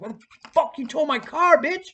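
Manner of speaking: high-pitched incredulous outburst; re-enacted angry confrontation, voice jumping up; pissed off. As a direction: demand an answer in outrage. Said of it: "I was pissed".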